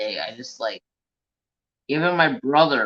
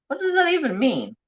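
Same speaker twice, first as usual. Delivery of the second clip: high-pitched, puzzled questioning; baffled question; 13 semitones higher, 3 dB louder than usual. Real, not acted. Streamer puzzling over a Canadian word, high baffled voice.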